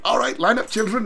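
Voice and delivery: high, put-on captain's command; mock stern captain. Captain lining up children in Sound of Music, mock command. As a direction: call out like a movie captain. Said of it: "the captain reminds me of Henry Hoof".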